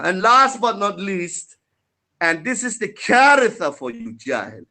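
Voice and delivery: ordinary delivery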